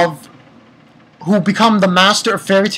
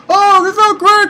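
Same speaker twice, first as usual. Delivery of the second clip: high-pitched imitation of revelers; mock celebrating; 13 semitones higher than usual. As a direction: imitate a merry crowd in a high voice. Voicing the guild celebrating.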